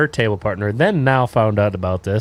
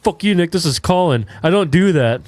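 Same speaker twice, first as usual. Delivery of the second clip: higher, louder mock outburst; retold angry yell; 6 semitones higher, 3 dB louder than usual. Classmate caught out yelling at Nick over text. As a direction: bark it back like an offended kid caught out. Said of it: "all of a sudden he goes".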